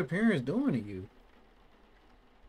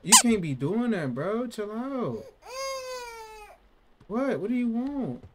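high, loud alarmed scolding; exasperated yelling; 10 semitones higher, 5 dB louder than usual. Real, not acted. Baby freaking out.

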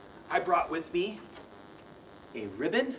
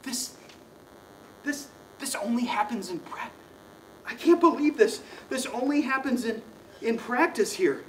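mock amazement; 6 semitones higher than usual. Trick works, mock amazement.

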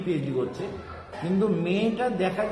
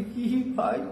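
grandmother's high reply; 6 semitones higher than usual. Retold or imitated. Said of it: "বলি".

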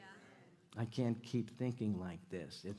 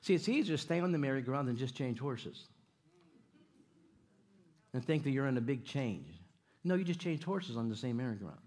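ordinary talk

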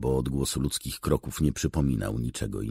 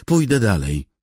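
stubborn insistence; 9 semitones higher, 9 dB louder than usual. A narrator's reading. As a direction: dig in, high and loud. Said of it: "zacięła się". (z uporem, podniesionym głosem)

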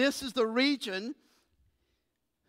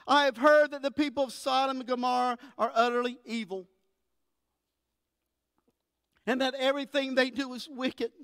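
raised, earnest Bible reading from the pulpit; scripture reading; 3 semitones higher than usual. Acted. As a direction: read the passage out with conviction to the congregation. Preacher reads Genesis aloud.

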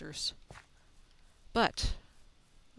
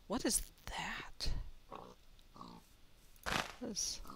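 hushed and wary; whisper; 3 semitones lower, 7 dB quieter than usual. Real, not acted. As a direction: whisper it, spooked. Spots something creepy.

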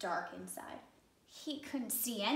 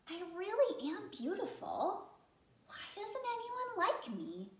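plaintive; 9 semitones higher than usual. Acted. Fish pours out troubles.

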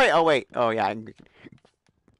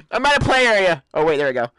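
ordinary talk, nothing marked

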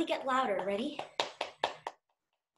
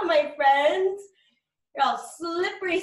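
ordinary talk

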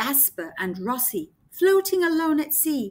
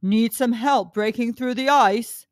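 whale voice; 2 semitones lower, 5 dB louder than usual. Acted.

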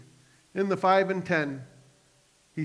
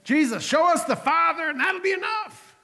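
much higher, near shouting plea; pleading Philip; 12 semitones higher, 4 dB louder than usual. Acted. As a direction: cry out begging to see the Father. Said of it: "It was Philip who said".

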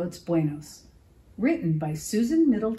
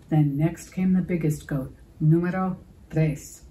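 ordinary delivery

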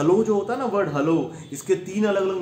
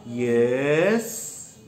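puzzled yes; 7 semitones lower than usual. Shown as a demonstration. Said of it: "तो यहाँ यस जो मैंने बोला".

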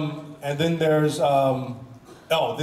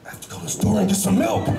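put-on Paul Blackthorne in a higher British accent; mock-posh British line, pitched up; British accent impression; 4 semitones higher than usual. Imitating a British actor saying the milk line in a British accent. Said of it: "would be".

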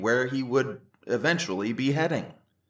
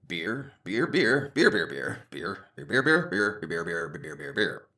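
Brett saying beer; 7 semitones lower than usual. Acted. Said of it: "That's what Brett was saying to the court".

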